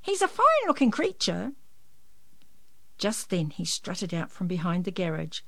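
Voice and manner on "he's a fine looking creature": neighbour voice. A narrator's reading. Mrs Frogmore voiced.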